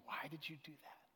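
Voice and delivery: soft unvoiced whisper; tearful whisper. A narrator's reading. Stewardess in tears asking Beth why, whispered. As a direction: whisper it through held-back tears. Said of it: "in tears".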